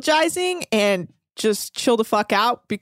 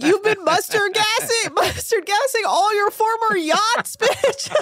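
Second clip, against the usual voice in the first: high, laughing mock accusation; high, slow, giggling jab; laughing mock scold; 10 semitones higher, slower than usual. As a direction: scold her through a laugh.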